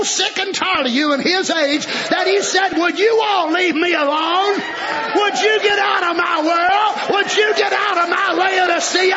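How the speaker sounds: shouted preaching, the devil's plea on top; preaching at full force. Real, not acted. Preacher voicing the devil fed up with holy rollers, shouted preaching. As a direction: rise and hammer each question.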